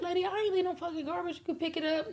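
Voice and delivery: high, quick mock retort; imitated indignant lady. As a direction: fire back in a high, put-on voice. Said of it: "And she was like".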